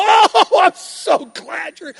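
high, loud oh then slow relief; cling on and cry out in joy; Mary embracing Jesus, joyous cry; overjoyed cry. Acted.

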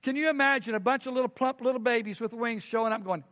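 ordinary talk, nothing marked

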